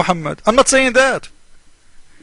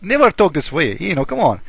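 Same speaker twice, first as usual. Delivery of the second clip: ordinary talk, nothing marked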